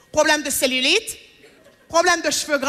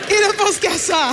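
drawn-out, higher exasperated complaint; slow exasperated sigh; 5 semitones higher, 3 dB louder than usual. Acted. Complaining to the women about men, slow and fed up. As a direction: stretch it out, fed up. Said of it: "repeated punchline, drawn out".

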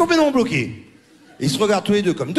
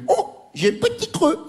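high, slow, hammed-up dreamy sigh; drawn-out, high comic longing; mock Joey craving a snack; 12 semitones higher, slower than usual. Comic mimicking the tennis star in the ad, high and slow. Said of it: "il fait".

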